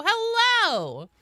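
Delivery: high, drawn-out loud hello; loud greeting. Real, not acted. Streamer saying hello to the chat, loud greeting.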